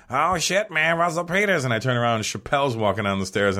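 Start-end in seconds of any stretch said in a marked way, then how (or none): 0.1-1.6 Chappelle greeting. Retold or imitated. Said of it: "I hear behind me".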